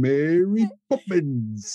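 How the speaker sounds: high, slow, hammed-up Cockney; mock Cockney accent.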